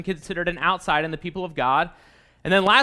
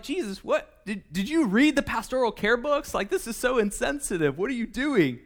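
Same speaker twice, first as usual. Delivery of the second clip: higher-pitched, mock-incredulous questioning; appalled disbelief; 3 semitones higher than usual. Readers objecting to Jesus' insensitivity, mock disbelief. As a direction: play dismayed and taken aback.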